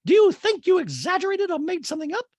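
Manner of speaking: high, loud mock interrogation; Dorit. Castmate challenges Crystal at dinner, imitated high and loud. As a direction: put on a high, emphatic housewife voice. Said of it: "and Dorit's like".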